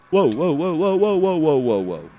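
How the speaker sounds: high, loud alarmed cries; startled whoa. Real, not acted. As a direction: caught off guard, yelling. Suddenly attacked by a blaze.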